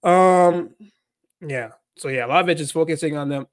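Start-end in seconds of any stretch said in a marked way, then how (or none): none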